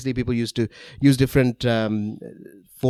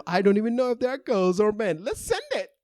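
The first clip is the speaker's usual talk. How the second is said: excited squeal; 9 semitones higher than usual. Acted. Voicing flasher, rising excitement.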